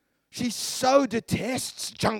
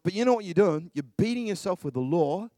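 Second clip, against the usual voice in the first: usual speaking voice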